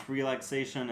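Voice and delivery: usual speaking voice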